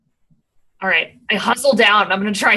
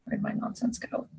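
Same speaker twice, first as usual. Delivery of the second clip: whispered aside; 16 dB quieter than usual. Real, not acted. Muttering while hunting dice.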